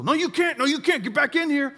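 loud, high-pitched imitation of a scolding lifeguard; shrieky-high mock orders, hammed up; mock lifeguard yelling. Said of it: "yelling at me".

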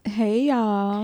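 stretched-out, swelling hello to the livestream; drawn-out live greeting. Acted. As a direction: croon it like an R&B intro. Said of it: "like the beginning of an Isley Brothers song".

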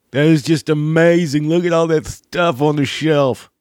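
high gushing gawker voice; mock wonder. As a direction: coo over the shelves. Mimicking gawking shoppers.

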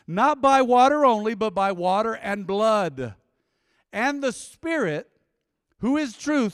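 loud, high, slow preacher's proclamation; emphatic scripture reading. Acted. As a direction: proclaim the verse phrase by phrase to the congregation. Reads scripture to congregation.

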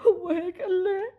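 high, choked-up disbelief; moved, voice lifting into tears; tearful question. Acted. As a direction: ask on the edge of sobbing. Moved by brother's message.

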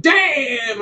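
high drawn-out sassy Damn; exaggerated sassy exclamation.

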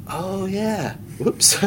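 sheepish caught out. Retold or imitated. Father-in-law sheepishly owning up before the crowd. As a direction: sheepishly admit you've been caught. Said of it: "the father-in-law is like".

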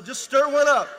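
teasing the married folks at full volume; loud preaching. Real, not acted. Preacher pushes playful point hard.

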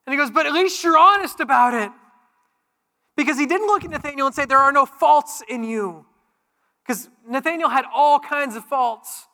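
loud, high, driving sermon voice; full-force preaching. Acted. Pastor to congregation on Jesus calling out goodness, full-force preaching. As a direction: preach it out with rising fire.

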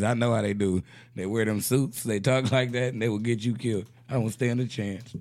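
usual speaking voice

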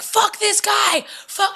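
high, drawn-out angry outburst, slow; a slow, high-pitched swearing outburst from the sister; a hammed-up furious "fuck this guy"; mimicking angry sister.

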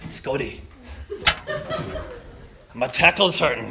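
put-on Scottish accent. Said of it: "a thick Scottish accent".

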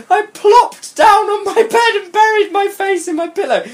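very high mock-dramatic squeal; hammed-up narration. Reader mocking the heroine plopping on her bed, shrill ham. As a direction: read it far too high, making fun of the melodrama.

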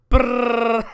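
rolled lips drum roll into a laugh. Pretend. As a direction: buzz a drum roll, cracking up. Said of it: "That's my drum roll".

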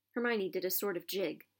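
plain reading voice

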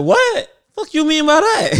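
mock-outraged what; offended disbelief. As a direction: play it insulted and baffled. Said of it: "I'm like".

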